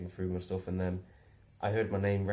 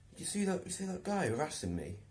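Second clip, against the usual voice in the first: high, indignant mock voice; imitating Steve; 10 semitones higher than usual. Steve complaining about harassment, high indignant mimic. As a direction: act wronged and shocked.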